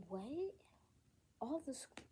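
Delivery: high rising question of disbelief; retold baffled reaction; incredulous. Pupil doubting the school's explanation, incredulous.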